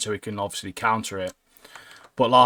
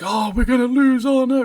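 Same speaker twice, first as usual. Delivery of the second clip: much higher hammed-up whimper of doom; mock panic; 11 semitones higher than usual. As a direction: play a panicking teammate, overdone. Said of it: "none of them are like".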